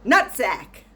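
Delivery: sudden loud yell of the word. Retold or imitated. Old patient yelling at the nurse, loud sudden yell. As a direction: blurt it out at full volume. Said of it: "and then he yells".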